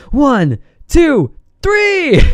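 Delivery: rising gleeful count, three squealed up high; excited counting. Real, not acted. Trader thrilled by his chart, counting waves in high glee.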